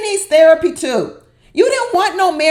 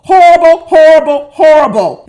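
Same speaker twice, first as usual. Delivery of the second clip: louder with each horrible fading down; loud repeated verdict; 3 semitones higher, 9 dB louder than usual. Real, not acted. Viewer declaring both cast members horrible, loud. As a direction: hammer it three times, loud then easing.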